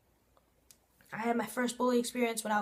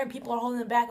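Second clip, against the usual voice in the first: usual speaking voice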